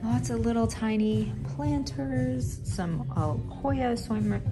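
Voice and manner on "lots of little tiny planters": normal talking voice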